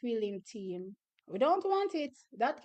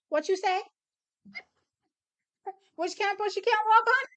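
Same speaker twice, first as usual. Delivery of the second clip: confronting challenge; 9 semitones higher, 4 dB louder than usual. Retold or imitated. Confronting the girl who threatened.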